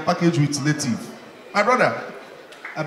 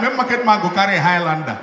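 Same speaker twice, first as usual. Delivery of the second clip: ordinary delivery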